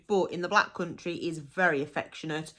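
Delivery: ordinary talk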